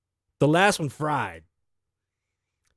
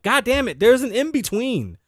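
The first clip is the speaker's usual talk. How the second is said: exasperated; 9 semitones higher, 3 dB louder than usual. Retold or imitated. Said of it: "And I'm like".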